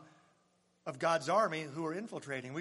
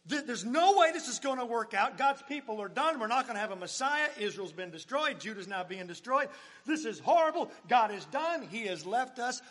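high, loud hopeless cry; hammed-up panic; mock despair; 5 semitones higher, 5 dB louder than usual. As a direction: play the doomsayer. Voicing despairing onlookers.

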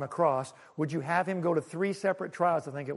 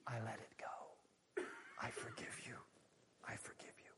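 breathy whispered forgiveness; 8 semitones lower, 23 dB quieter than usual. Acted. God whispering forgiveness to the sinner, hushed. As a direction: let each word fall away to a whisper.

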